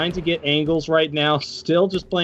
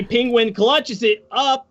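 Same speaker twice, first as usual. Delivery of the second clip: high and drawn out clutch call; excited call; 9 semitones higher, slower than usual. Real, not acted. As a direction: stretch the words, thrilled. Round-winning clutch call.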